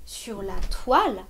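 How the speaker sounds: "toile" appuyé, plus fort; stress on toile. Shown as a demonstration. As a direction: insist on "toile". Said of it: "sur toile qu'on va insister". (accent sur « toile »)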